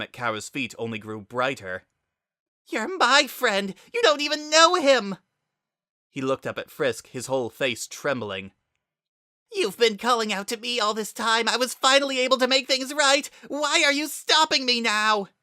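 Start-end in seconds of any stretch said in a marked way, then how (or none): 2.7-5.2 put-on desperate boy's cry. A narrator's reading. Kara begs his friend not to side with Frisk, high desperate cry. 9.5-15.3 put-on desperate boy's cry. A narrator's reading. Kara begs his friend not to side with Frisk, high desperate cry.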